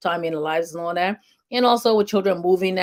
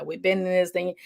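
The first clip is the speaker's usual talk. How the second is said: normal talking voice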